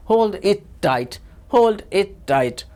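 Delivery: each word drawn out very slowly; slow drill. Shown as a demonstration. Teacher slowly drilling a phrase for students, very slow. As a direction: spell it out slowly for the learners. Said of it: "revision करते हैं".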